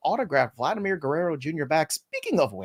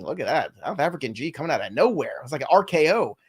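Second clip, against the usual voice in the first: ordinary talk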